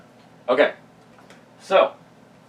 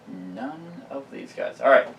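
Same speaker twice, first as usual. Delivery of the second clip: normal talking voice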